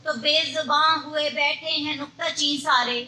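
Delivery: measured Urdu mushaira recital; poetry recitation. Acted. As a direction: recite verse in long, even phrases.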